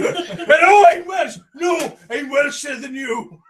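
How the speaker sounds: put-on high, loud sing-song Welsh accent; mock Welsh. Friends having a Welsh off, loud mock Welsh. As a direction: shout it in a hammed-up Welsh accent. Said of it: "A Welsh off".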